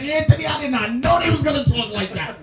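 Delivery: high, loud mock girlfriend voice through laughter; quoting her. Mocking the girlfriend's shocked complaint, high and laughing. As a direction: squeal it out like the girlfriend. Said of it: "She's like".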